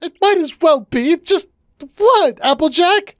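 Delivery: very high, upset character voice; distraught. A narrator's reading. Cupcake confronting Applejack, high and distraught. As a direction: pitch it way up in distress.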